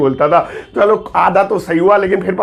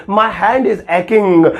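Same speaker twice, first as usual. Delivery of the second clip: my hand is aching, drawn out slowly; slow model sentence; much slower than usual. Shown as a demonstration. Teacher modelling the correct sentence slowly.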